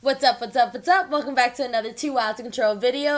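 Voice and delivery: loud, upbeat YouTuber greeting, fast; excited intro. Real, not acted. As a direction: hype up the viewers.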